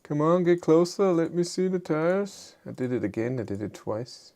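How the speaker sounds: usual speaking voice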